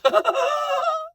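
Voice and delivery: imitating a ridiculous high laugh; imitated ridiculous laugh. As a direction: cackle like the singer. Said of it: "he does his".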